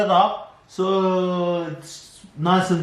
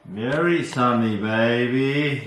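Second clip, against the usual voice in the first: lower, smooth teasing drawl; 9 semitones lower than usual. Acted. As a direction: tease her in a deep mock croon. Partner teases in low voice.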